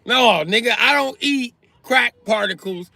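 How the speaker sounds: high, loud, fast indignant rant; heated comic rant; angry rant. Acted. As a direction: tell him off hard. Ranting back at the crackhead.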